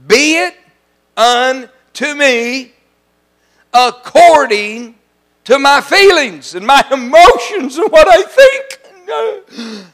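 high, slow, crying half-shout; mock wailing misquote. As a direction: overdo a whiny self-centred prayer. Mocking misreading of Mary's reply.

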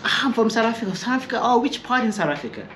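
high, animated retelling; acted conversation. Acted exchange with Savannah locals.

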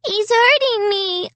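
put-on high, slow child's wail; very high-pitched whine of pain; crying little girl. A narrator's reading. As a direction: cry it out like a hurt small child. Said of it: "Alicia cried".

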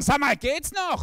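high, indignant mock outrage; a congregation member snapping in disbelief; outraged churchgoers. Empörte Leute. (empörte Kirchgänger, hoch und entrüstet)

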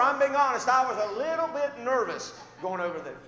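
loud, high preacher's storytelling; preaching at full force. Acted. Preacher telling the congregation his story, loud. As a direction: drive the story home from the pulpit.

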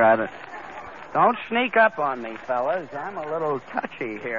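ordinary talk, nothing marked

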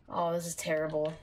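ordinary talk, nothing marked

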